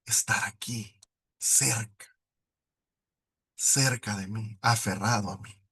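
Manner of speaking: low, soft, slow inner voice; God speaking gently to his heart. Retold or imitated. God speaking to his heart, low and soft. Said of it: "Dios empezó a hablarme a mi corazón y me decía".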